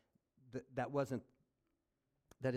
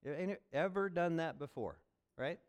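usual speaking voice

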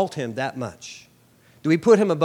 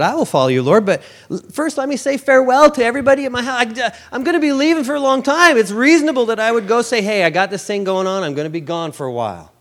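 much higher, faster, earnest follower; excuse-making follower; 9 semitones higher, 7 dB louder, faster than usual. Retold or imitated. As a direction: rush through the excuses, keen and higher.

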